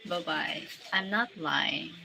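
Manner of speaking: ordinary talk, nothing marked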